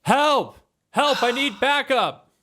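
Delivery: high-pitched cry for backup; acted, high yell without full volume; yelling for help. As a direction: yell for help as an alarmed guard. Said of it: "turns around and he yells".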